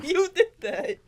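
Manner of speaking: talking through high laughter; laughing. Real, not acted. Teasing a friend about buzzing like a bee, laughing. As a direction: giggle the words out at him.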